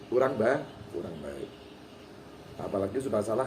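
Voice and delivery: normal talking voice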